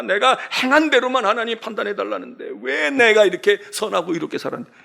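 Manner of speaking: ordinary delivery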